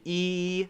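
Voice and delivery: long, drawn-out "E"; long E sound. Shown as a demonstration. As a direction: stretch "E" into a long, steady ee. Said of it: "the long sound of E".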